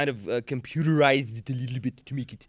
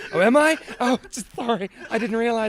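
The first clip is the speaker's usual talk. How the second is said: much higher, sheepish voice; mock apology; 12 semitones higher, 4 dB louder than usual. Caught copying friend's accent, high mock apology. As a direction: squeak out a put-on innocent apology.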